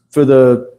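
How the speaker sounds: burp in the middle of a word. Real, not acted. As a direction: talk through a small burp. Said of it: "excuse me, I'm burping".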